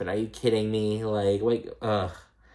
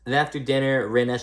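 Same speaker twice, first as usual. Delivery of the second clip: usual speaking voice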